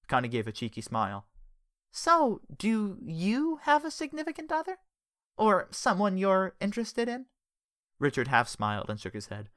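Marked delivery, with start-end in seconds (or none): 1.9-7.3 slow, high teasing question. Acted. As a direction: tease him playfully. Connie teasing.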